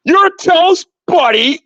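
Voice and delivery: high mock imitation of her taunt; mocking her catchphrase. Imitating a rival's corny catchphrase, high and mocking. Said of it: "she actually believes that's a good way to pwn somebody by saying".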